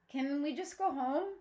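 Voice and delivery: usual speaking voice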